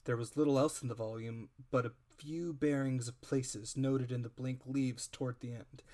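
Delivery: plain reading voice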